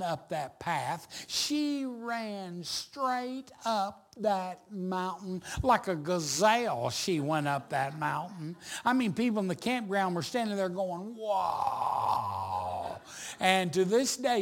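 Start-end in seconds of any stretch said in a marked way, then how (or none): 1.3-5.4 slow, word-by-word emphasis; emphatic slow telling. Real, not acted. Pastor marvelling at his wife's climb, slow and emphatic. Stressing the mother's astonishing climb. 11.1-13.0 long, low drawn-out awe, imitated; amazed onlookers. Campground people watching her climb, awestruck. Campers gaping at the running mother.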